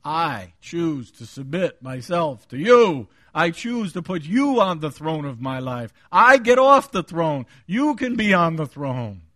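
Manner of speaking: loud, deliberate preaching declaration; emphatic vow to God. Acted. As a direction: pledge surrender forcefully, word by word. Models a prayer of submission.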